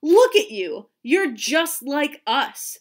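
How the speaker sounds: loud, scornful taunt; jeering kids' put-down; sneering. A narrator's reading. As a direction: sneer it at her, drawing it out. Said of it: "they sneered".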